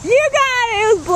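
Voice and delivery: cheering praise; excited. Real, not acted. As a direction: celebrate the right answer. Cheering child's answer.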